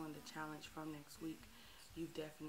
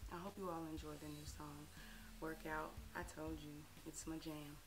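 normal talking voice

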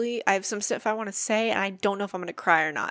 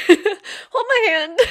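loud, slow, laughing repeat of the offer; playful laughing plea; 11 semitones higher, 9 dB louder, much slower than usual. Real, not acted. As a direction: burst out laughing as you say it again.

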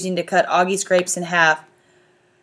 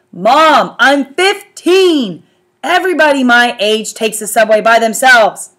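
put-on exasperated teen; 4 semitones higher, 7 dB louder than usual. A narrator's reading. Teen arguing with mom.

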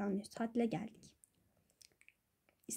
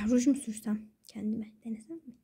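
whisper; 4 semitones lower, 7 dB quieter than usual. Real, not acted. Girl whispers alone at bedtime. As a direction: muse it under the breath. Late-night musing to herself.

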